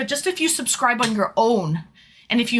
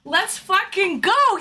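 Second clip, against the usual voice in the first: normal talking voice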